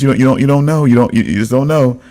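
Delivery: ordinary delivery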